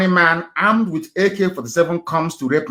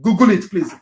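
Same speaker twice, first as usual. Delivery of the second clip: ordinary talk, nothing marked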